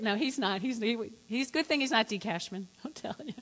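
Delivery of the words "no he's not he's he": ordinary talk, nothing marked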